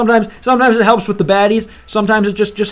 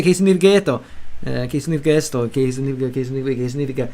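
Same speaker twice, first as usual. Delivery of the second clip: streamer mimics a learner asking what a word means; 5 semitones lower, faster than usual. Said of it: "Then you just say".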